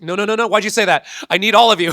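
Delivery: high, loud frantic protest; panicked plea. Retold or imitated. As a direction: beg them not to leave, alarmed. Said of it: "I was like".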